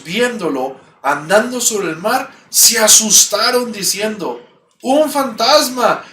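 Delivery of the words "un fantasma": frightened cry. Acted. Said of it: "dieron voces de miedo".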